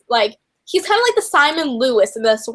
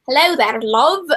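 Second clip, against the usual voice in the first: put-on Scottish accent. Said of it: "in a Scottish accent".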